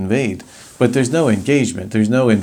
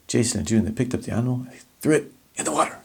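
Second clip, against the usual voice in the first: "Jason and June, they picked up the animal" hushed, softer and fast; soft and quick; 8 dB quieter, much faster than usual. Shown as a demonstration. Teacher showing a soft, quick take of the sentence. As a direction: rush the sentence out softly, almost whispering.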